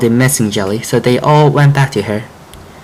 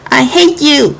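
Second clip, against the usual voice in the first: tearful yell; slower than usual. Acted. Friend with broken guitar yelling at frog, hurt and tearful. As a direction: shout it through tears. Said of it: "Fly yelled, with tears in his eyes".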